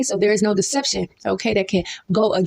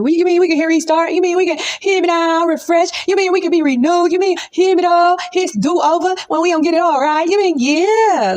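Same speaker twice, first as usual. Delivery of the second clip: excited disbelief; 8 semitones higher, 4 dB louder than usual. Real, not acted.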